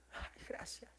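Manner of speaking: grateful hushed whisper. Retold or imitated. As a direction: whisper the thanks, moved. Hermanos llorando de alegría. (susurro agradecido)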